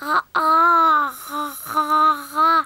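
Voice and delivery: talking with mouth wide open. Acted. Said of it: "C'est très difficile de parler avec la bouche grande ouverte".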